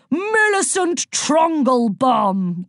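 high, slow, officious announcement; drawn-out, self-important declaration; declaring. A narrator's reading. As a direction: proclaim your own name grandly. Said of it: "declared the woman".

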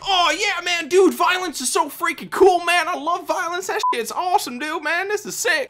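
high-pitched mock kid hyped on violence; eight-year-old kid. Mocking the kids these ads are meant for, squeaky hyped-up child. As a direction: gush like an overexcited little boy, voice way up. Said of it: "like, eight-year-old kids who run around".

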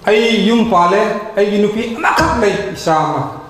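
ordinary talk

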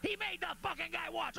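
scandalized shout leaning on he; mock outraged yell. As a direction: holler it again, hitting he hardest. Imagined crowd yelling accusation.

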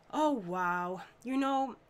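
ordinary delivery

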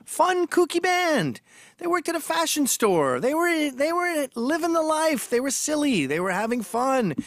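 high, bouncy mock-cheerful telling; playful sing-song mockery; mock cheery. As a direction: sing it out like a cheery kids' tale. Mocking cheery description of band.

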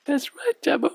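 squeaky, high cartoon puppet sidekick. Puppet co-host Lani speaks.

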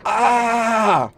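startled shout. Real, not acted. Suddenly remembers mistake.

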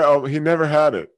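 normal talking voice

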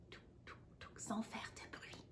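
whispered tiptoe steps. Acted. Reading to a child, whispering as the tortoise sneaks past.

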